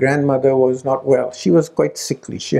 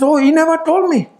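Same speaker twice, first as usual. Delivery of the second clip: high dismayed cry, retold; dismayed realization; 14 semitones higher, 4 dB louder than usual. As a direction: let the betrayal land in a high voice. Mother hurt by the discovery.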